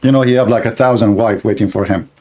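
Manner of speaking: ordinary talk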